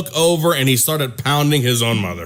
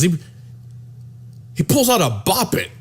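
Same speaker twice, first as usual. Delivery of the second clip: ordinary talk, nothing marked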